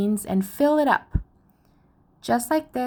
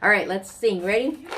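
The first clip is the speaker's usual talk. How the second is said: ordinary talk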